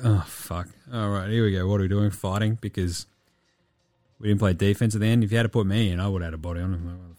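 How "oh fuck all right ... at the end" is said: deflated teammate mock.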